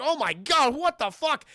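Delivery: loud, high mock alarm; mock panic. Twins chased by a giant gnome creature, mock panic. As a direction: exclaim in put-on horror.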